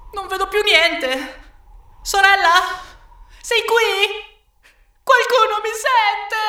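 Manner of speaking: put-on very high, lost child's call; alarmed calling out. A narrator's reading. Lost Tommaso calls for his sister, alarmed and high. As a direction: call out anxiously into emptiness, voice pitched right up. Bambino smarrito chiama la sorella.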